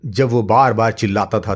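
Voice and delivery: ordinary delivery, nothing marked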